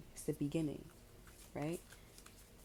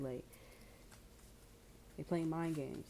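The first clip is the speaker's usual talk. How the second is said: ordinary talk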